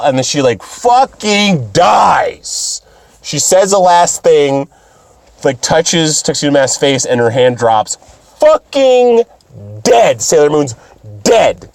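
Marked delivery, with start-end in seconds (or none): 0.6-2.8 very slow, higher emphatic shout; drawn-out exclamation. Real, not acted. Dramatic recap of death. 8.4-11.7 shocked exclamation. Real, not acted. Shocked announcing death.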